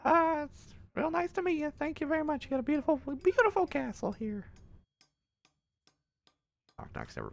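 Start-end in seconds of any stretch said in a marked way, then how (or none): 0.0-4.4 very high, loud folksy greeting; squeaky, eager, gushing stranger; high character voice. Acted.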